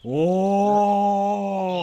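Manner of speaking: high, loud shocked "Oh!"; loud startled yelp; sudden loud cry. Acted. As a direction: cry out in alarm. Sudden outcry in scene.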